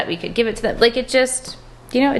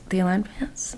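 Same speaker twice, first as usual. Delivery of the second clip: hushed reverent whisper of the name; 7 dB quieter than usual. Shown as a demonstration. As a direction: breathe the name like a secret. Whispered repeat of product name.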